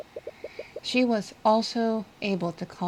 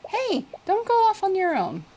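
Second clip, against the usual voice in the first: ordinary delivery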